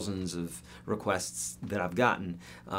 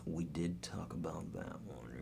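a soft whispered aside, stress on did; teasing whisper; 4 semitones lower, 14 dB quieter than usual. Acted. Teacher whispering to chat that he already answered. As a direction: whisper it like a private joke to the viewers.

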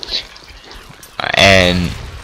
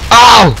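high, loud "ow" yelp; sudden yelp; 14 semitones higher, 6 dB louder than usual. Real, not acted. Player hit in the game, sudden yelp.

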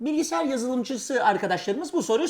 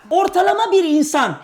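loud, high quiz-host announcement; emphatic game-show opening; quiz question; 6 semitones higher, 5 dB louder than usual. Real, not acted. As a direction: launch the question with a big raised voice.

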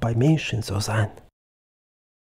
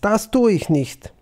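loud, higher-pitched refusal; calling out; 7 semitones higher, 5 dB louder than usual. A narrator's reading. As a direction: refuse firmly with a raised voice. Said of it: "rief Agnes".

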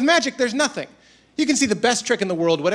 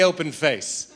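usual speaking voice

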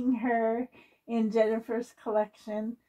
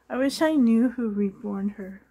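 normal talking voice

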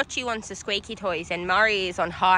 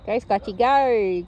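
usual speaking voice

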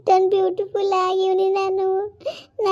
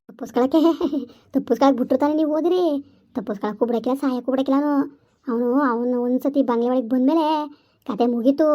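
low, eerie ghost warning of the trafficker; 6 semitones lower, faster than usual. Acted. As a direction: speak low like a knowing ghost. Ghost voice again. (ತಗ್ಗಿದ ದೆವ್ವದ ಧ್ವನಿ)

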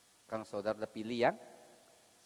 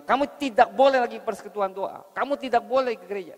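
much higher and louder forbidding shout; shouting husband; 12 semitones higher, 15 dB louder than usual. Acted. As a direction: yell the ban like a furious husband. Husband forbidding prayer meetings, church.

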